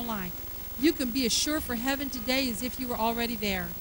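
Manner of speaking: soft, low invitation over music; gentle altar call. Acted. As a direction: invite them to come forward.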